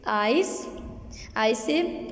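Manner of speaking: ordinary talk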